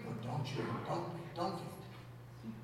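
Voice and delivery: whispered diet nagging, slowing at the end; mock whisper. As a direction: hiss it like a nagging inner voice. Mocking diet advice whispering.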